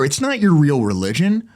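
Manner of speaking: usual speaking voice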